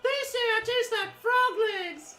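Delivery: high-pitched little creature voice; childlike wonder. Acted. Player voices a character curious about his captor, childlike wonder. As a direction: pipe it up high, full of innocent wonder. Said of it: "I say this with, like, a sense of childlike wonder".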